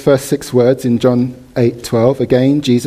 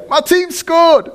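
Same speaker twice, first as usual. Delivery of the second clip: high, drawn-out celebratory cry; slow, high-pitched triumphant yell; jubilant football fan; slower than usual. Acted. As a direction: shout it like a fan after a goal. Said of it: "and your team scores".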